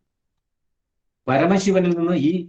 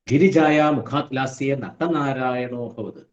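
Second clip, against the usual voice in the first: slow, chanted Sanskrit verse; measured scriptural chant; sloka recitation; slower than usual. Shown as a demonstration. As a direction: intone it like scripture.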